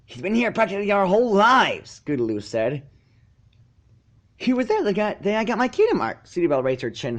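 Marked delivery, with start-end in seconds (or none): 0.0-2.0 higher, louder filly voice; higher, firm; filly standing up for Spike; Scootaloo. A narrator's reading. 4.4-6.3 high haughty filly voice; proud filly telling the crowd; Sweetie Belle, haughty. A narrator's reading.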